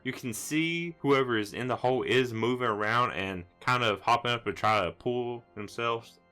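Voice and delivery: ordinary delivery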